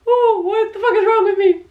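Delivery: high voice, crying and half laughing; tearful. Real, not acted. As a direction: scold yourself through real tears. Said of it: "on the verge of freaking tears".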